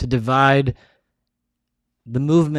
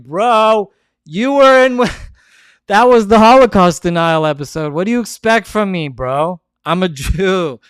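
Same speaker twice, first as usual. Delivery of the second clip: retold reply; 5 semitones higher, 5 dB louder than usual. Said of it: "I was like".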